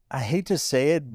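normal talking voice

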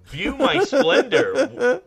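high, loud, drawn-out mock proclamation; grandiose mad scientist. Mad scientist to horrified onlookers, hammed-up boast. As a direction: declaim it slowly through laughter.

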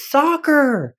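put-on high, drawn-out kid's shout-out; kid calling out. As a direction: blurt it in a high voice from across the room. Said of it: "Just like that".

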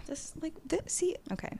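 hushed whisper while reading her notes; breathy unvoiced whisper, half to herself. Real, not acted. Reading her podcast list to herself in a whisper.

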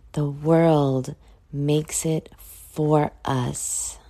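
very slow emphatic close. Shown as a demonstration. Stressing her life lesson.

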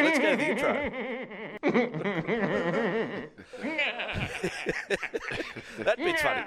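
high, slow words through real laughter; can barely get it out for laughing; cracking up while introducing the joke. Real, not acted.

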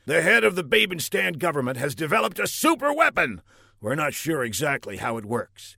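brief him like a pompous general. Acted. General briefs Buck on plot.

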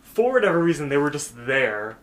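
ordinary talk, nothing marked

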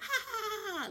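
high, forced nervous ha-ha-ha; mock nervous laugh. Boss laughing nervously at the resignation letter, mock giggle. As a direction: fake a jittery little laugh. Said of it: "'cause he was nervous".

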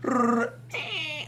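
high-pitched trill into a squeak; imitated creature noise. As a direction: mimic a little creature butting into the shootout. Imitating a creature's noise mid-shootout.

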